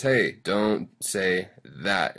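very slow, with "that" set apart; drawn-out quote landing on "that"; stress on "that". Shown as a demonstration. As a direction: spell out the italics by leaning on "that". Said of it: "that is slanted 'cause it's italicized".

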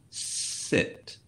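hushed, whispered command; very soft, deliberate dog command. Shown as a demonstration. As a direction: whisper the order with a smile. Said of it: "lower my voice and say it like that".

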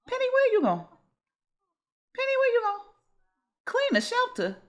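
high, sing-song calling voice. Real, not acted. Gamer calls after her wandering sim Penny, high and sing-song. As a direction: squeak her name up high as she wanders off.

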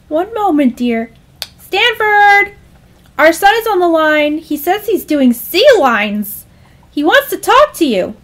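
high, loud, singsong mom; high motherly announcing; mother calling out. Acted. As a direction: holler for your husband across the house. Mother calls to father.

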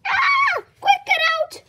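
high, loud panicked yell; panicked shout. Acted. Kid urging friends to flee, panicked yell. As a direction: scream the escape order. Fleeing scary place.

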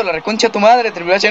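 ordinary delivery

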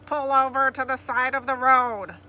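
very loud amplified order; police loudspeaker. A narrator's reading. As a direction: boom it out over a loudspeaker. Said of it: "One of them activated the outside speaker".

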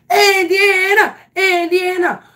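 high, loud call of her name. Retold or imitated. Coach calling out to the batter, loud. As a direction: shout to get her attention.

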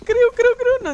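very high, very loud ecstatic shout; excited screaming. Real, not acted. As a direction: scream in disbelief at the lucky move. Repeated lucky hit. (gritos de euforia muy agudos)

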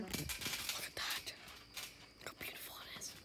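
hushed whisper. Real, not acted. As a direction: whisper it like a secret. Whispers while showing his base.

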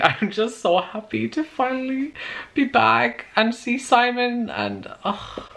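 high, tearful, fighting to get it out; emotional, happy tears. Real, not acted. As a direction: overcome with joy at a reunion. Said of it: "Why am I getting emotional".

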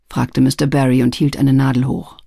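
ordinary delivery

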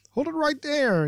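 high-pitched host cutting in; interjecting. Acted. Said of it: "interjects Biff".

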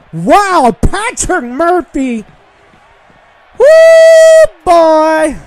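big excited whoop, way up high; shrieking "Woo" then a loud "boy"; hype yell. Acted. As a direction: cheer yourself in like a crowd. Hyping his own wrestler's entrance.

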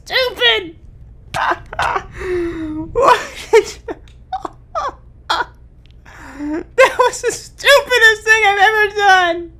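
high, shrieking laugh-cry; shocked laughter. Real, not acted. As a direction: laugh in disbelief at yourself. Laughing at own fatal blunder.

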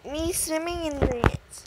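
ordinary delivery

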